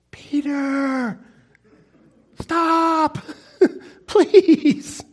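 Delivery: high, broken plea with a laugh; tearful high plea to Peter; pleading. Acted. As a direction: beg him to stop, voice cracking upward.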